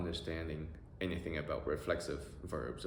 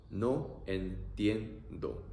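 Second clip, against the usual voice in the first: very slow No entiendo. stretched; teacher modelling slowly; slow demo; much slower than usual. Shown as a demonstration.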